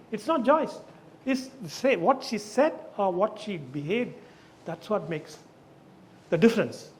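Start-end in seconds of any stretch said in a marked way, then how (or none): none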